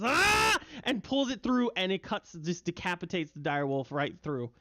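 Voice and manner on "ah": high, loud hammed-up war cry; hobgoblin battle scream. Hobgoblin finishing the dire wolf, high battle scream. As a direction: let out a high effortful yell as the blade pulls through. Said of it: "finally screams and goes".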